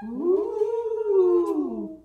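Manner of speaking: loud, high ghost moan; haunt the attic with it; ghostly ooh. Pretend. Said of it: "Do you hear that coming from your attic?".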